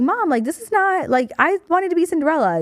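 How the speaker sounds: put-on high pleading little-kid voice; whiny kid plea. Child begging her mom for the Cinderella costume, high and whiny. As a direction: beg mom like a disappointed five-year-old.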